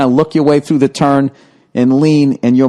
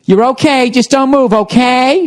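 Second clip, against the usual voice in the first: fussing lady; 8 semitones higher than usual. Retold or imitated. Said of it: "she just kept going".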